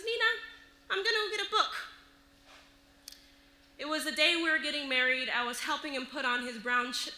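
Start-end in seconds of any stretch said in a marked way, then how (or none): 0.0-1.7 lightly voiced child, higher pitch; young boy. Boy putting the magazine down, lightly voiced child. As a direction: say it higher, like an unbothered kid. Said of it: "and says".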